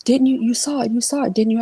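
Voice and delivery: panicked mother pressing for an answer; urgent mother. Retold or imitated. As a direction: voice her frightened, insistent question. Mother voiced, urgently questioning.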